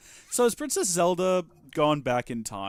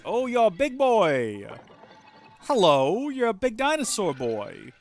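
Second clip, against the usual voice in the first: cooing; 8 semitones higher, 5 dB louder, slower than usual. Real, not acted. Greeting a big creature.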